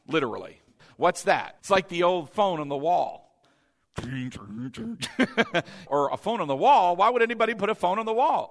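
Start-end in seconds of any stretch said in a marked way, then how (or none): 4.0-5.8 playful imitation of an old wall phone; mimicked old phone noise. 5.9-8.5 louder, rising into quick disbelief; baffled youngster. Acted. Mimics baffled younger listeners.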